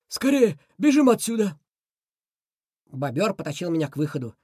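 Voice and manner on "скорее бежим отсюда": high, loud urgent cry to run. Acted. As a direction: shout it in a panic. Beaver urges fleeing.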